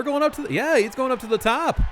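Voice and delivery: excited high play-by-play; rising hype as he climbs; wrestling commentator. Real, not acted. Calling the top-rope climb.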